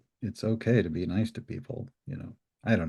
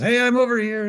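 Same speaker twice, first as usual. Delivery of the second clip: high, loud shout across the water; calling out; 9 dB louder than usual. Retold or imitated. Fisherman shouting to the approaching boat, loud high call. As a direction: call out to warn the other boat. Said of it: "and he goes".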